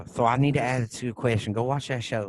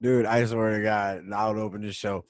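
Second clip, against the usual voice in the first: ordinary talk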